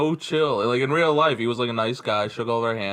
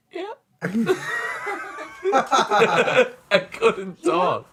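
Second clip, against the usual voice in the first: high, very slow words squeezed out through laughter; helpless laughter; 10 semitones higher, much slower than usual. Real, not acted. Recalling being starstruck, laughing the words out slowly. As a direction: laugh so hard the words stretch.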